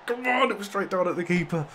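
high, loud outburst at the save; real delight, high and loud; excited shout. Real, not acted. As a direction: cry out as it's saved. Keeper saves penalty.

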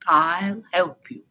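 slow, kindly Badger voice. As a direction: offer help slowly and gently. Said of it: "said Badger kindly".

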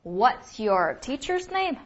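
slow, clear "What's your teacher's name"; slow model question. Shown as a demonstration. Teacher models the question slowly for students. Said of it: "应该怎么问".

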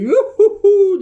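high, loud whooping yell with a laugh; mock Pokerev's gleeful shout at a big pull; imitating a streamer's excited whoop. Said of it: "diye bağırıyor Pokerev".

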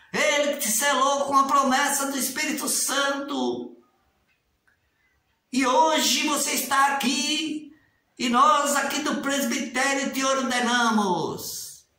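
ordination prayer. Retold or imitated. Critic reenacting the elder's ritual ordination prayer, slow and solemn. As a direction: intone it like an elder ordaining. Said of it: "É assim".

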